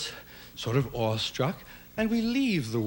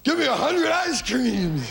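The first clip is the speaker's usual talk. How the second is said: high, slow greedy order; mock demand, much higher and louder; demanding kid; 11 semitones higher, 3 dB louder, slower than usual. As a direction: bark it like a kid let loose at the counter. You can go and say.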